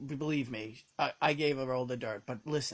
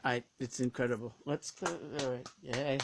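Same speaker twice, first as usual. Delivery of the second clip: ordinary talk, nothing marked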